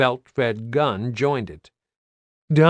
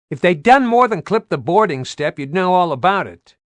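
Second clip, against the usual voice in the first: raised gruff pilot voice; pilot over radio; 6 semitones higher, 6 dB louder than usual. Acted. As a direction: shout it over rotor noise.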